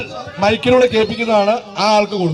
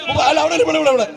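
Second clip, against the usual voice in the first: usual speaking voice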